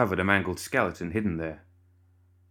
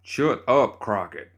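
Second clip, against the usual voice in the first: shouting; 2 semitones higher, 4 dB louder, slower than usual. Acted. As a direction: snap it loudly at Crockett. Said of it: "Bernadette and Cornelius shouted".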